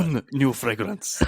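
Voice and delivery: slow, drawn out fake French; a light fake French ad voice; mock French accent. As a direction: mimic the perfume ad's French. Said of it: "fake French accent".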